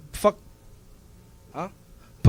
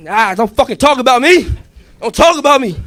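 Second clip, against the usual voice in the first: high, loud taunting yell; gloating shout; 8 semitones higher, 10 dB louder than usual. Acted. Bull dancing on Jose, high loud gloating. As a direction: crow over him as you stomp.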